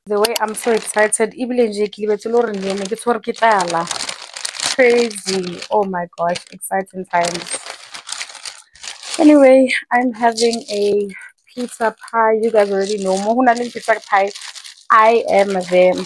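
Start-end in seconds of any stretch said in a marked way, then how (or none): none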